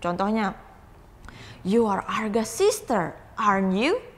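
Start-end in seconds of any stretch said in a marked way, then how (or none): 1.6-4.0 "aren't you" swinging up as a check; rising tag intonation. Shown as a demonstration. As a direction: lift "aren't you" at the end like a real question. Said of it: "the intonation's going up".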